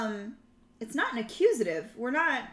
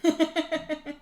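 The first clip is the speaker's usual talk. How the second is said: short laugh; 5 semitones higher than usual. Real, not acted. Streamer laughing at her own Latin slip. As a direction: laugh at herself.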